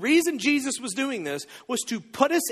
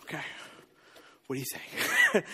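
sheepish mumble; 6 semitones lower, 6 dB quieter than usual. Acted. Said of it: "he goes".

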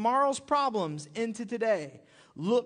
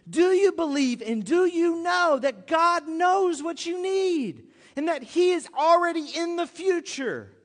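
louder, higher earnest plea; impassioned preaching; 5 semitones higher, 5 dB louder than usual. Acted. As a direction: press the question home. Preaching climax.

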